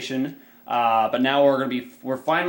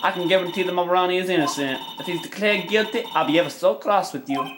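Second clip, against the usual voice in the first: put-on raised British-accented woman's voice; 6 semitones higher than usual. Sweetheart vouches for Ronnie to Nicky boy, British accent. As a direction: speak as a British sweetheart, a bit high. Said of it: "I'll do a British accent for her".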